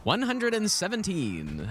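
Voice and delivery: high, slow, drawn-out score call; darts announcer. Pretend. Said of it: "I sound like a, like a darts announcer guy".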